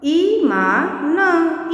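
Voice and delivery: very slow Iman, each sound held apart; syllable by syllable. Shown as a demonstration. Teacher sounding out Iman for children, syllable by syllable.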